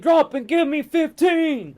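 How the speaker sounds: high, loud barking sergeant order; drill sergeant. Retold or imitated. Imagined drill sergeant orders the speaker, loud barked command. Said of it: "drill sergeant came up and was like".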